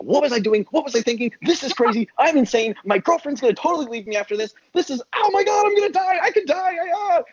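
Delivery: high, frantic mock panic rising to a yelp; imitated skydiving panic, voice climbing very high; panicked inner voice. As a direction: replay the terror of the open plane door. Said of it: "You're like".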